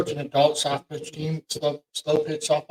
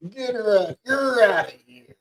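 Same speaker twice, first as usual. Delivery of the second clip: lightly acted umpire throwing someone out, raised pitch; mock ejection call; 6 semitones higher, 5 dB louder than usual. Co-host echoing the call to eject the wife, mock ejection shout. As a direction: play at ordering a fan out, voice pitched up.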